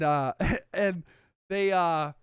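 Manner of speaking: ordinary talk, nothing marked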